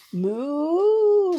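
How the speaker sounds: big moo, high and loud. Acted. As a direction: shout the moo proudly. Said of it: "just as loudly as possible".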